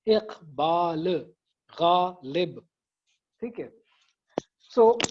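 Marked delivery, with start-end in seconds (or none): 0.1-1.3 Pakistan said very slowly, split into syllables; counting syllables. Shown as a demonstration. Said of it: "चार syllables हैं". (पाकिस्तान को धीरे धीरे syllable में तोड़कर बोलना)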